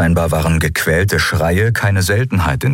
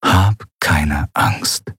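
very slow, lower, softer echo; softer inner echo; 3 semitones lower, much slower than usual. A narrator's reading. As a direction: let it fade like a memory. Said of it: "Vielleicht ihre eigene".

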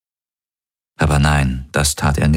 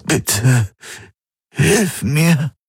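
begging man; 9 semitones higher, much slower than usual. Acted. Garlin begs.

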